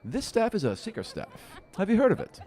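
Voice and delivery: a sly little seeker teasing his find; halfling seeker. Acted. Halfling explains his staff.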